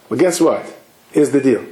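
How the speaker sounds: ordinary talk, nothing marked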